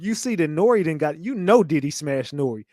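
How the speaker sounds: ordinary talk, nothing marked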